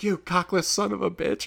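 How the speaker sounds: a hint of a barking drill sergeant; lightly voiced sergeant insulting a recruit; mock drill instructor yell. Drill instructor yelling at a recruit, mock yell. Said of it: "The drill instructor's yelling at him".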